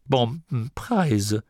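slow, groaned dismay; groaning. A narrator's reading. Gurda sees the feared figure ahead, groaning. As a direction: groan it out slowly. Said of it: "stöhnte Gurda".

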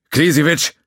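loud, high shout of a name; calling out. Acted. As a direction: call him over the alarms. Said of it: "rief Mock".

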